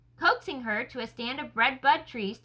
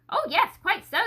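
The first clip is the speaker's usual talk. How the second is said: startled awake; 6 semitones higher, 3 dB louder than usual. Acted. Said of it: "as if waking himself".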